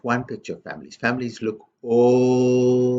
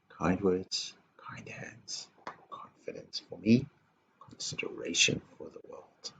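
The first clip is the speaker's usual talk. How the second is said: softer and softer down to a whisper; fading to whisper; 11 dB quieter than usual. Acted. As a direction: let the list sink into a hush. Recapping values, fading to hush.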